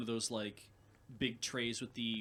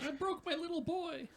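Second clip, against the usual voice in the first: mock despair. Joking about a snapped clamp.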